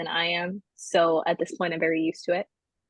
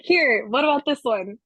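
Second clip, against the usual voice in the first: body's voice; 5 semitones higher, 5 dB louder than usual. Retold or imitated. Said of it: "So then my body's like".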